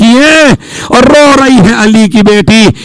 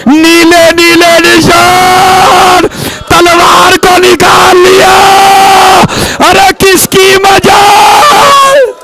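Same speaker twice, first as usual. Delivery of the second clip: keening elegy, pitched up and stretched; wailing majlis recitation; 5 semitones higher, slower than usual. Acted. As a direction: cry the grief out to the mourners.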